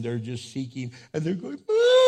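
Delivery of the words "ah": very high, loud mock wail; child's wail. Preacher imitates a child hurt on playground, loud high wail. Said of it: "they're crying and they're wailing".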